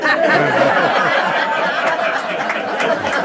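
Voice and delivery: high, unrestrained laughter at his own punchline; real delighted laugh; big real laugh. Real, not acted. Laughs at professional liar line.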